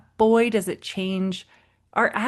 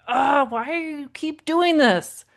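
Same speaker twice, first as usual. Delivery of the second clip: mock yell; 8 semitones higher than usual. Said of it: "I'd wanna maybe yell at him".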